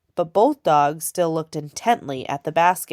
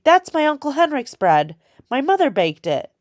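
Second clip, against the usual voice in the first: higher, louder and indignant; angry; 9 semitones higher, 5 dB louder than usual. A narrator's reading. Girl scolding the soldier over her uncle's bread, angry. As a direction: defend the bread, fiercely. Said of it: "she said angrily".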